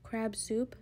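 ordinary delivery

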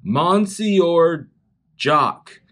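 Monsieur loud and high as "mon-see-or", pause, Jock; same misreading. Retold or imitated. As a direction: sound out Monsieur, then drop Jock after a beat. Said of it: "say it how I said it before".

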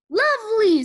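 high, loud delight; delighted exclamation. A narrator's reading. Missus Watson welcoming officer's yes, delighted.

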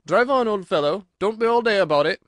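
loud, high creature voice; impatient Gryphon. A narrator's reading. Gryphon urging the Mock Turtle onward, loud and impatient. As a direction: chivvy them along loudly.

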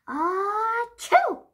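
big sneeze; loud high sneeze. Acted. Character sneezes in story.

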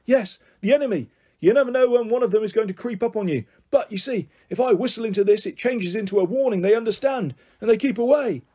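put-on high-pitched, confiding old eccentric; eccentric grandpa. Grandpa warns the children about creeping enemies, eccentric and earnest. Grandpa character explains imaginary enemy.

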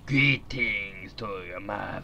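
slow, low mock Russian accent; lightly put-on Russian accent; a hint of a Russian accent. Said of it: "She is like Trojan horse".

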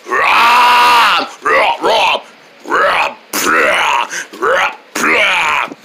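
repeated short roars, first one loudest; beastly roar. Acted. The big opponent threatens the monkey, beastly roaring.

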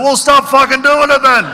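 high-pitched mock heckle; heckler shouting out. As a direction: call it out high, as a fed-up heckler. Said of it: "Some guy up the front shouts out".

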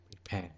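soft "prepare", stressed on its second syllable; softly weight "-pare" in "prepare". Shown as a demonstration. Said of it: "rơi vào âm tiết thứ hai".

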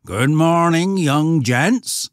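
loud, high rustic greeting; hearty country shepherd calling out; old shepherd. Acted.

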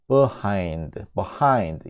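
behind as "buh-HIND", first vowel a schwa; reduced first syllable. Shown as a demonstration. Teacher models the correct pronunciation for students, reduced first syllable. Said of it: "이런 식으로 발음이 되는 거예요".

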